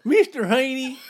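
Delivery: high, loud character voice; squeaky TV neighbor impression; Mister Haney voice. Imitating Green Acres character.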